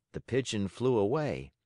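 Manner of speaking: plain reading voice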